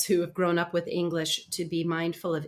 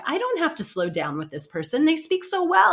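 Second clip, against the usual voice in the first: breezy confident; 7 semitones higher, 5 dB louder than usual. Retold or imitated. Voicing a native speaker's thought.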